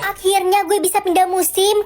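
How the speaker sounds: usual speaking voice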